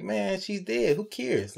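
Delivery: lightly voiced careless Daryl; imitating Daryl. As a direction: shrug it off like you don't care. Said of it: "Daryl was like".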